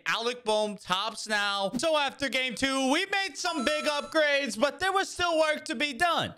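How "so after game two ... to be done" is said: higher, even voiceover read; video recap narration. Acted. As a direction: narrate the recap like a highlight reel. Recap narration over gameplay.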